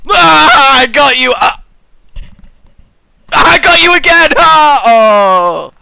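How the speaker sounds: gleeful prank shout. Pretend. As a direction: leap up yelling gotcha. Jumps up after fake death.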